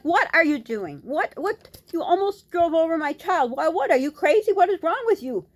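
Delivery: high, loud, outraged shouting; furious yell. Retold or imitated. Mother yelling at reckless Uber driver, furious shout. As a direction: yell at the driver as if he nearly hit your child.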